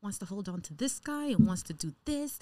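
normal talking voice

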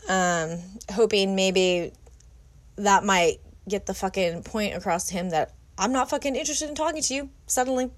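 ordinary talk, nothing marked

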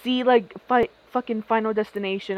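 ordinary talk, nothing marked

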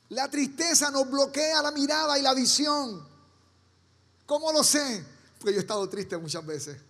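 usual speaking voice

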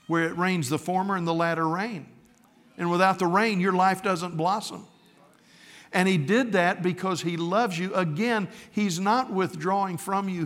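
fervent preaching. Acted. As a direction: lift it up and press the point home to the congregation. Preaching builds to full force.

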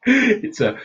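bursting into a high, loud laugh; real laugh. Real, not acted. Speaker cracking up calling the inner voice an idiot, loud high laugh. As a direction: crack up at your own joke. Laughs at own joke about mind.

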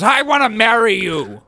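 loud, high, over-the-top declaration; mock-passionate yell; mock shouted proposal. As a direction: belt out a hammed-up proposal. Acting out a grabbing proposal.

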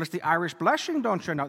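quick, badly faked Irish lilt; horrible Irish accent. Priest jokingly answers what being Irish has to do with the reading, bad Irish accent. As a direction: put on a hammy Irish accent. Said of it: "That's a horrible accent".

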